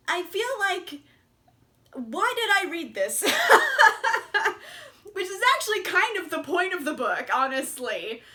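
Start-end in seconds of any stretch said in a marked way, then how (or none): 3.3-5.1 high, loud real laugh; a sudden loud laugh after a rhetorical question; burst of laughter. Real, not acted. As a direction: crack up at yourself. Laughing at her own reaction.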